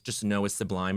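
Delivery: ordinary delivery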